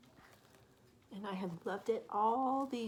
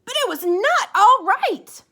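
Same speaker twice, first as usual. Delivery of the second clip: high, loud, slow reveal; dramatic storybook line; 14 semitones higher, 23 dB louder than usual. A narrator's reading. Storyteller announcing to children the house went wrong, loud and slow.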